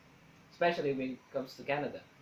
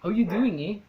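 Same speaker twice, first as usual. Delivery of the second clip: higher, louder Canadian lilt with an "eh"; Canadian greeting, tagged with "eh"; Canadian accent; 5 semitones higher, 6 dB louder than usual. Shown as a demonstration. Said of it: "they always have to add an".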